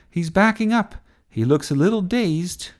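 high, excited play-by-play; TV commentary. A narrator's reading. Sophie calling Sir Roderick's retreat, excited commentary. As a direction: relish the opponent's retreat. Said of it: "doing the commentary for T V".

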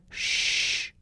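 soft whispered shush. Acted. Sophie hushes Sebastian while hiding, whispered shush. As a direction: hush him without voice.

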